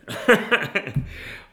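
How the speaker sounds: loud, high short laugh. Real, not acted. Preacher urging the church to pray, laughing. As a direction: laugh heartily after exhorting. Laughs after exhorting prayer.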